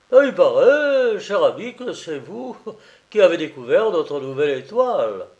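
gushing, high and loud opening; effusive journalist. Acted. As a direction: greet a dear friend with loud, lilting excitement.

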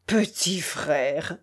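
slow, drawn-out low mimicry; mocking imitation. A narrator's reading. Tchelkash apes the peasant's plea, mockingly. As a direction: mimic his plea, dragging it out. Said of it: "dit Tchelkash en le contrefaisant".

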